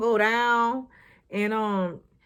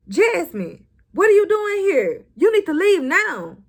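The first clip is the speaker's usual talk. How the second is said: high, loud startled order; high loud outburst at the intruder; shocked demand; 6 semitones higher, 5 dB louder than usual. Retold or imitated. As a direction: demand she leave right now. Gary confronts Jasmine, shocked.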